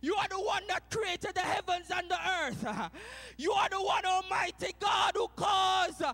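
loud, high, rousing praise preaching; shouted praise. Acted. Habakkuk praising God, preached at full force.

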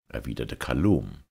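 ordinary delivery, nothing marked

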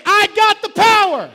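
full shout, high; screamed. Shown as a demonstration. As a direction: scream it like you'd explode. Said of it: "Say it like, like if you screamed it".

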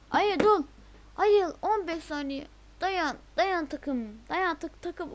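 high, loud, drawn-out urging; tense shouted encouragement; frantic pleading. Real, not acted. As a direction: beg the team to hang on, slowly. Urging team to hold on.

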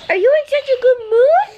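excited coo. Real, not acted. Mom asks her cheerful toddler, bright and quick. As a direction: bounce it at him.

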